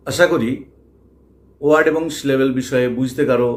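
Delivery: normal talking voice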